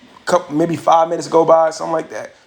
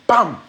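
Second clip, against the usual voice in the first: sudden, higher-pitched "bam"; punch sound; 5 semitones higher than usual. Retold or imitated. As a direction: pop it out like a hit landing. Imitates the second punch landing.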